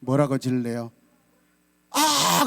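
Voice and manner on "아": a brief high "아!" with no real force; the useless little yelp; weak cry. Shown as a demonstration. Pastor mocks a weak cry that does no good, a high yelp. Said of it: "그럴래요? 그러면 효과 없어".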